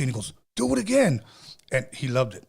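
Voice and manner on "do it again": high-pitched mock excitement; retold eager demand. Brother asks the scratcher for more, retold eagerness. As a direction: urge an encore, pitch jumping up.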